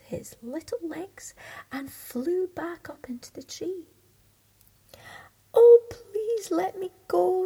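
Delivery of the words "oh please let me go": high, loud pleading cry. Acted. Thumbelina begging the beetle to release her, loud and high. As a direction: beg to be let go.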